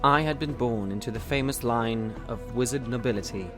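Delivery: ordinary talk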